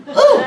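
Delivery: high, loud playful ooh; surprised ooh. Real, not acted. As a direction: yelp at your own cheeky joke. Reaction after devil joke.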